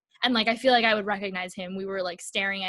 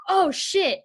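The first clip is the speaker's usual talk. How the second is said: startled curse; 9 semitones higher, 5 dB louder than usual. Retold or imitated. Intruder realizes wrong apartment.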